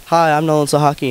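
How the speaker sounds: ordinary talk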